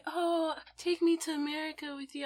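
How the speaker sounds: high-pitched mock begging; pleading imitation. Voicing relatives who beg to come along to America, mock pleading.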